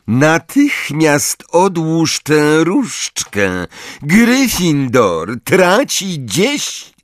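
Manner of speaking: slow, high, stern command; stern professor. A narrator's reading. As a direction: order it slowly and coldly. Snape orders Harry.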